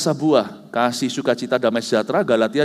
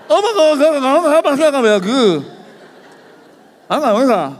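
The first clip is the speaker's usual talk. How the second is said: much higher and louder mock scolding; imitated ranting; 13 semitones higher, 7 dB louder than usual. Dentist ranting against charismatics, hammed-up scolding. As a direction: rattle off nggak like an angry rant. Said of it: "dia ngomel, ngomel, ngomel".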